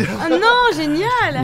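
loud high delighted cry. Real, not acted. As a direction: burst out in delight. Reacting to the Wookid mix-up.

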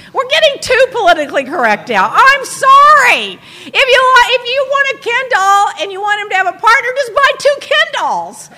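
high, loud exasperated rant. Real, not acted. Ranting about Ken dolls.

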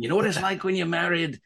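lightly put-on American stand-up patter; mock American stand-up. Writer mimics American comics to fellow comedians, hint of brash showman. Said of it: "doing this kind of".

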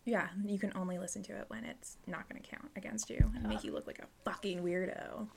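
soft conspiratorial whisper. Real, not acted. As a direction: whisper it like a secret. Conspiratorial aside.

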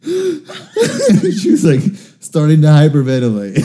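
talking through hard laughter; cracking up while telling it, high and loud; laughing. Real, not acted. Storyteller recalls the sick girl's reaction, laughing.

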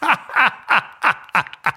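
loud, high gloating laugh; villain's cruel cackle; mocking laugh. A narrator's reading. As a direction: laugh down at her. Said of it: "Duryodhana laughed at Draupadi".

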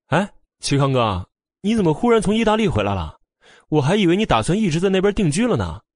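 pleased, lilting chatter; happy greeting. A narrator's reading. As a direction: greet a returning friend with glee. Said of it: "穆言飞也很高兴".

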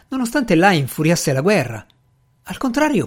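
ordinary delivery, nothing marked

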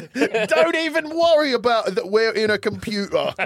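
high, exaggerated British accent; silly mock-British, breaking into laughs; every British accent. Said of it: "I'll do every accent there is".

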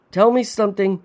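high, loud call across the ice. A narrator's reading. Son testing the voice below, calling out. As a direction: call it out to the water. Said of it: "I called".

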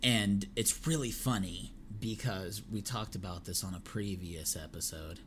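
normal talking voice

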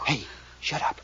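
hushed warning; a man hushing the girl at a noise; urgent whisper. Acted. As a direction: whisper sharply so no one hears. Hearing someone in the hall.

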